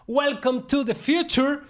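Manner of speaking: slow, high showman announcement; announcer voice. Pretend. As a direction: present it like a grand reveal. Hyping the metaverse topic.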